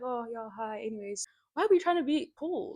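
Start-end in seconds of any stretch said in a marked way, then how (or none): 0.0-1.3 soft, bored brush-off; quiet uninterested greeting; bored indifference. Retold or imitated. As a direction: sound like you don't care. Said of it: "just act like".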